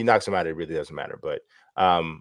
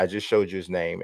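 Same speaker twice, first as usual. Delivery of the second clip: ordinary talk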